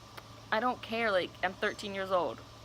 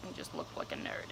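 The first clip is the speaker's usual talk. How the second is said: under-breath whispered repeat; voiceless muttered aside; 13 dB quieter than usual. Real, not acted. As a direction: whisper it to yourself as a dry afterthought.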